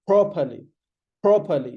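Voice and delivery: properly punched hard on PROP; "properly" as PROP-uh-lee, falling; pushed P. Shown as a demonstration. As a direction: push the first syllable of properly. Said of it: "You have to push it".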